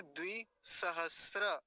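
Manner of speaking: द्विसहस्र loud and slow, ending "sra"; द्विसहस्र with no t in the last cluster; correct pronunciation. Shown as a demonstration. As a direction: punch out द्विसहस्र in pieces. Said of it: "अब इसको सहस्त्र नहीं बोलेंगे".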